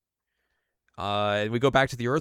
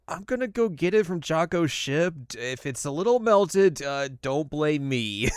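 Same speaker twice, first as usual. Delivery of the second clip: grudging Bulma; 6 semitones higher, 3 dB louder than usual. Retold or imitated. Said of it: "she's like".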